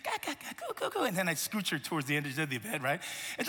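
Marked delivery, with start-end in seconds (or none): 0.0-1.0 put-on soft, high-pitched baby cooing; tiny, high gurgling coos; baby babble. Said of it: "and she'd be like".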